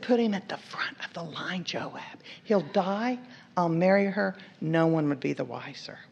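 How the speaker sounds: soft, low conspiratorial murmur, name half-whispered; hushed order. Acted. David secretly ordering Joab, hushed. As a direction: say it like a hidden plot.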